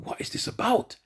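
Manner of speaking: unvoiced whisper of "what is this about?"; whispered wonder. Preacher whispering his puzzled thought about the woman. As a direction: whisper it to yourself.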